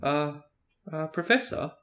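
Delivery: cautious. A narrator's reading. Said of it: "approached Professor Lupin cautiously".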